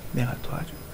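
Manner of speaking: God gently whispering an offer of help; whispered offer. Acted. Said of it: "이렇게 여러분에게 말씀할 거예요".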